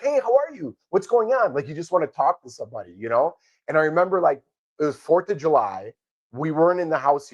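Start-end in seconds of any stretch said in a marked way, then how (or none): 0.0-1.5 eager greeting. Retold or imitated. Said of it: "when people bring your food, you're like".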